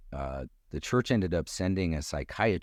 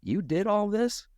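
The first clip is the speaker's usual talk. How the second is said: amazed question. Retold or imitated. Elder asking him, amazed.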